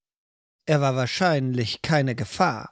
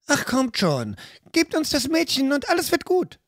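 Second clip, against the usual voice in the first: cheerfully lying; 10 semitones higher than usual. Acted. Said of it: "log Fiala fröhlich".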